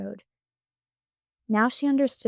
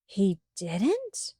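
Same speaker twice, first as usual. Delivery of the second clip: a hint of faked surprise; mock surprise. A narrator's reading. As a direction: play it as feigned surprise. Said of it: "pretending to be surprised".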